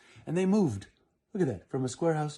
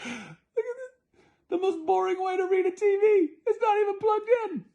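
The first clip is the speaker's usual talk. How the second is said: amused high exclaim; 8 dB louder than usual. Real, not acted. Reader amused by picture.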